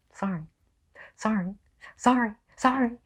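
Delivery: high, squeaky-pitched repeated sorry, climbing; mock apology. Ranker to people who say it wrong, mock apologetic.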